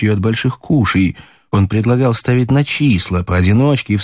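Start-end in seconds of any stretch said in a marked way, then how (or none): none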